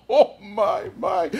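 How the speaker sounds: high chuckling oh my; Santa chuckle. Acted. Santa chuckling at the bird, high. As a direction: laugh merrily.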